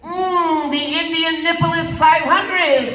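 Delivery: high, loud showman; mock grand punchline. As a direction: deliver the pun with a flourish.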